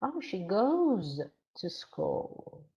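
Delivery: GOES stretched slowly with rising-falling pitch; stress on goes. Shown as a demonstration. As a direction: echo it back, swelling on GOES. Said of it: "Put stress on that word".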